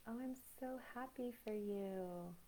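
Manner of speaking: soft, hollow congratulations with a fake smile; fake happiness. Congratulating a friend through a fake smile, soft and hollow. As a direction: put on a forced smile and say it. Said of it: "We've all been the person that we're like".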